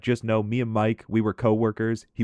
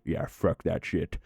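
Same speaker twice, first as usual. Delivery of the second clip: softer, slow, lower dismissive aside; muttered aside; 5 semitones lower, 5 dB quieter, slower than usual. Retold or imitated. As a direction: say it under your breath behind his back. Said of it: "shake his head no".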